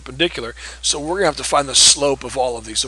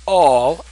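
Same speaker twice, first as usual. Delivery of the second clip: all punched, louder; emphasis on all; 3 semitones higher, 7 dB louder than usual. Shown as a demonstration. Teacher reading directions to class, stressing all. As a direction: hit the word all hard. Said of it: "emphasis on the word all".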